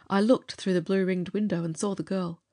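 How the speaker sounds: ordinary delivery, nothing marked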